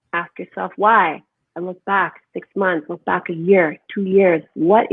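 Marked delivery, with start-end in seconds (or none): none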